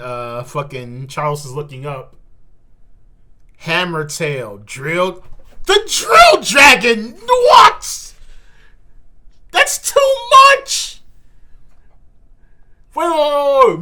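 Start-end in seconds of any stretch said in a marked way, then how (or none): 5.6-13.8 real disbelief, yelled much higher and louder; slow, stunned shout at the page; shocked outburst. Real, not acted. Shocked by manga reveal.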